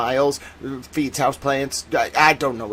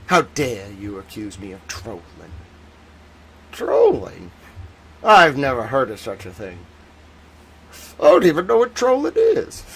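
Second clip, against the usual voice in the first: mock indignation; 4 semitones lower than usual. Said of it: "you gotta be like".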